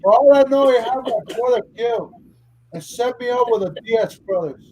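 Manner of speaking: mock Tony Montana, spitting suspicion; a friend hams up Scarface lines, Cuban accent; Scarface quote. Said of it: "start quoting Scarface".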